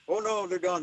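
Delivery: mock Irish man saying they fled. The Irish man saying the directors fled, in a mock Irish accent. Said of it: "I'm gonna stop doing my Irish".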